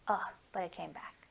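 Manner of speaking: soft, secret whisper. Acted. Reading a picture book aloud.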